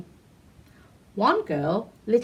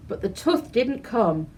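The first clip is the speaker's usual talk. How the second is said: ordinary delivery, nothing marked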